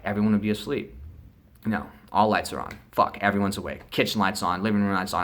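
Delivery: usual speaking voice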